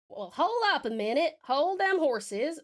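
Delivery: slow Southern drawl, hold them horses. Acted. Southern drawl to chat while drawing the tail.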